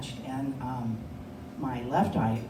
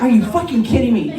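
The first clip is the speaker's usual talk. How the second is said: exasperated outburst; 7 semitones higher, 11 dB louder than usual. Retold or imitated. Exasperated at lost pill.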